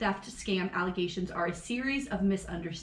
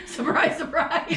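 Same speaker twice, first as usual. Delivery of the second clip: higher, breaking into a laugh; 5 semitones higher, slower than usual. Real, not acted. Podcast host reacting to a politician's stance, laughing. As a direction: let a real laugh take over the repeat.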